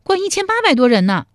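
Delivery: high, loud heroine's boast; high-pitched proud announcement; proud young woman. Acted. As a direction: show off in a high voice.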